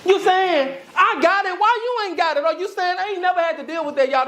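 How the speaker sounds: high-pitched, fired-up sermon delivery; impassioned preaching. Real, not acted. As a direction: preach it with urgency, voice lifted high.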